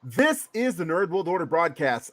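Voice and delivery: big, loud announcer, names stretched out high; ring announcer intro. Pretend. Host presenting his co-hosts to listeners, hyped-up ring announcer. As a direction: build each introduction to a long, soaring shout.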